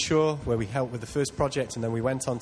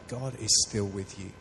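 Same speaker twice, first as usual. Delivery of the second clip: soft reassurance; 5 semitones lower, 4 dB quieter, slower than usual. Acted. Congregation member reassuring themselves, soft and slow. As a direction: lower and softer, slow. Telling yourself comfort.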